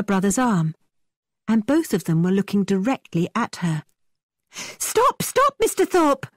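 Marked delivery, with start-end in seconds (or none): 4.5-6.3 much higher urgent cry; impatient cry. A narrator's reading. Catherine crying out to Thorpe to stop, high and urgent. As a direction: call out to stop the carriage. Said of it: "Catherine cried impatiently".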